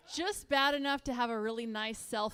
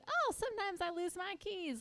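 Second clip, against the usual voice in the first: high, airy imitation of a trivial complaint; mock ditzy; 8 semitones higher, 5 dB quieter than usual. Comic mocking people claiming ADHD, ditzy. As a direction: pitch it up, making light of it.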